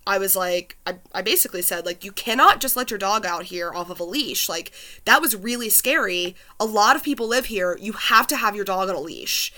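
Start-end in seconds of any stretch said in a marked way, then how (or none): none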